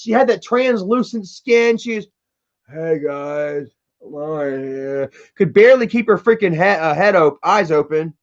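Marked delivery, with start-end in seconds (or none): 2.7-5.1 low, soft, slow sleepy mimic; doped-up drawl in a low soft voice; mock drowsy Lauren. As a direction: imitate her half-asleep greeting. Mocking sister's drowsy intro.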